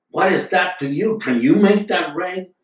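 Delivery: high, loud challenging question; acted challenge. Acting out Tajuddin challenging the tantric.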